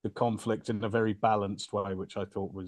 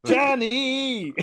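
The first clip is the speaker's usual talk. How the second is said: high, loud, drawled [name] voice; Chalamet's [name] in Dune; 9 semitones higher, 6 dB louder, slower than usual. Retold or imitated. Chalamet stuck in [name] voice on the Dune set, hammed up. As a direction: ham up a stoned [name]. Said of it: "he's like".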